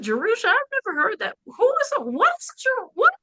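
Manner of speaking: very high, incredulous re-enacted reaction; baffled outburst. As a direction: squeal your confused questions in mock bewilderment. Acts out own baffled reply.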